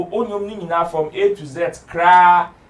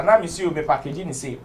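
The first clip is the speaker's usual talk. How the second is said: ordinary talk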